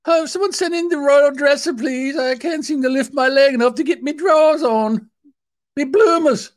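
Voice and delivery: high, fussy royal falsetto; hammed-up Queen. Queen calling for the royal dresser, in hammed-up falsetto. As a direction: fret in a very high mock British old-lady voice. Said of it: "probably she dresses herself".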